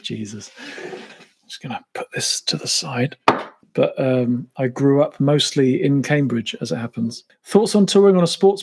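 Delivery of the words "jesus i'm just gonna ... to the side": much softer, slow, muttered; quiet self-deprecating aside; embarrassed aside. Real, not acted. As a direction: drop to a low mumble to yourself.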